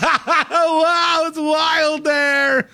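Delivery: high, slow, wide-eyed wow; amazed studio reaction. Retold or imitated. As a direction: gasp it out like stunned anchors. Studio reacts to wild scene.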